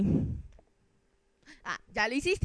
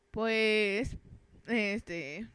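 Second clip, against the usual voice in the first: usual speaking voice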